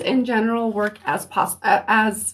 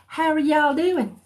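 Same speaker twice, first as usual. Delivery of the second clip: put-on southern accent; 6 semitones higher than usual. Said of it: "feeling a little southern".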